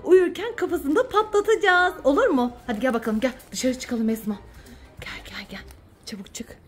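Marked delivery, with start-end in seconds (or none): 5.0-6.6 urgent whisper. Real, not acted. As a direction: hurry them out in a whisper. Sneaking out quietly.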